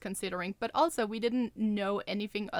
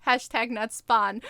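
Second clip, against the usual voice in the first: mock disclaimer; 4 semitones higher, 8 dB louder than usual. Joking disclaimer, loud and slow.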